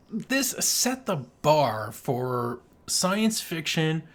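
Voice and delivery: ordinary delivery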